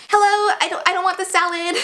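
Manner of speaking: high-pitched mocking complaint; rude customer. Rude woman complaining at the sandwich counter, mocked in a high voice. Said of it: "how rude she was to him".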